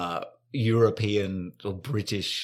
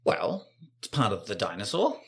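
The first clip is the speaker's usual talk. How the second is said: ordinary talk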